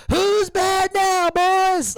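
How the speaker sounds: high, loud, slow taunt; mock wrestler taunt, high and loud; menacing wrestler taunt. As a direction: drag it out like a heel gloating. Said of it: "they're like".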